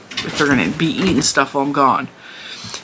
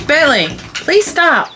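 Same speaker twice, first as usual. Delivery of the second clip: pleading; 10 semitones higher, slower than usual. Real, not acted. Scolding puppy on camera.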